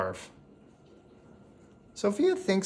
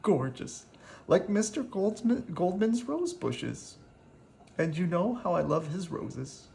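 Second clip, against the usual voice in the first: put-on tender old woman; 6 semitones higher, 4 dB quieter than usual. Missus Goldman moved by gift.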